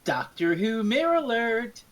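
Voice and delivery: ordinary delivery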